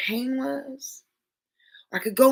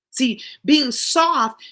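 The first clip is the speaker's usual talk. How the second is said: slow emphasis; 3 semitones higher, 7 dB louder, much slower than usual. Real, not acted. Preaching point.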